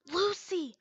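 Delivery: whispered name, then a sharp hissed question; shouted whisper. Acted. Levy pulls Lucy back, whisper-shouting at her. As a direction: scold in a loud whisper. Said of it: "Levy shouted in a whisper".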